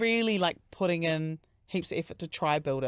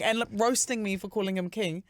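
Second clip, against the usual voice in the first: usual speaking voice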